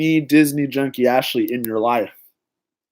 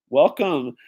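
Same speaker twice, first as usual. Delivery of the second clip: ordinary delivery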